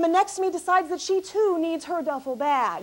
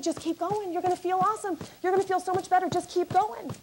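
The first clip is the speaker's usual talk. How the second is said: softer, calm soothing; soothing coaching; 6 dB quieter than usual. Retold or imitated. Comic coaching a panicking seatmate, softer and soothing. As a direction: ease her down gently.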